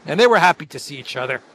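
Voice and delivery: normal talking voice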